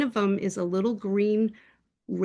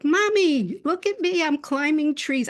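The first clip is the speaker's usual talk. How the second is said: excited little pet voice, pitched right up; high-pitched little cat; 7 semitones higher, 4 dB louder than usual. Retold or imitated. Cat showing off to owner from heaven, high and excited. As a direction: imitate a tiny cat calling out high. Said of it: "It was like a high-pitched voice".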